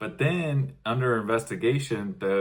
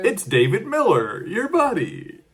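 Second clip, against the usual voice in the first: high, loud, singsong announcement; mock friendly reply; 10 semitones higher, 6 dB louder than usual. Masked stranger identifies himself to the man, mock chummy. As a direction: call out in a silly high voice.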